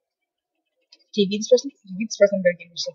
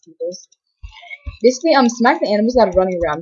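ordinary talk, nothing marked

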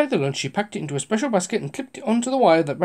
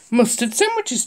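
chuckling; 7 semitones higher than usual. Acted. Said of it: "chuckled Mister Grinling".

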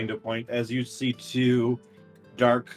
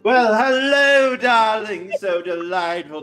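posh host voice; 7 semitones higher, 7 dB louder than usual. Acted. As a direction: gush hello like a glamorous host. Greets players in character.

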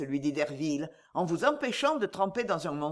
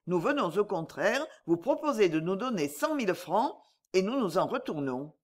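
ordinary delivery

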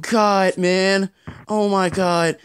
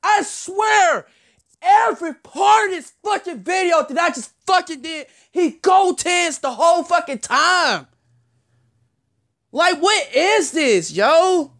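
high-pitched, exasperated yelling; angry rant; 7 semitones higher than usual. Real, not acted. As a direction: rant at the cheating game in a raised voice. Ranting at unfair game calls.

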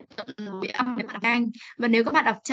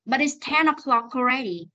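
"But it's ten o'clock already" in one quicker run; fast linked reading. Shown as a demonstration. Teacher contrasts for the class, fast linked reading. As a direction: link "o'clock" straight into "already". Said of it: "Còn nếu đọc nhanh này".

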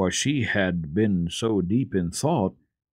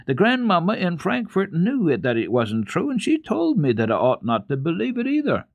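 put-on higher, insistent young girl; girl's voice; 9 semitones higher than usual. A narrator's reading. Girl insisting to the boy, high and firm. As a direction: press the point home.

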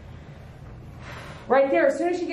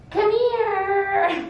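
high, drawn-out silly call; mock coaxing; 8 semitones higher than usual. Shown as a demonstration. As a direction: sing it out high, as a joke. Mock sweet call, then laughs.

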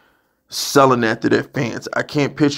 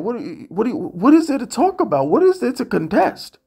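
much higher, incredulous questions; exasperated disbelief; 9 semitones higher than usual. Real, not acted. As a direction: plead in disbelief, voice up high.

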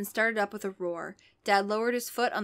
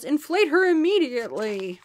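high, loud monster voice; monster demand; 6 semitones higher, 6 dB louder than usual. Acted. A horror ordering the crowd, monster voice. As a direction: make it a shrill horror's demand.

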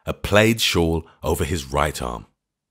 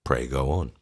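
gently; 5 semitones lower, 5 dB quieter than usual. A narrator's reading. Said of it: "I said gently".

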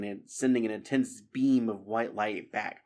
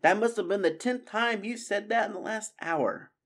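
put-on much higher young mouse voice, exasperated; exasperated mouse scolding; Matthias complaining; 11 semitones higher than usual. Matthias scolding Constance for repeating herself, exasperated.

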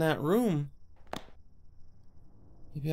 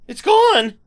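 much higher and louder, sudden panic; alarmed cry; 13 dB louder than usual. Acted. Girl finding her charm gone, panicked shout. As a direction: cry it out in horror.

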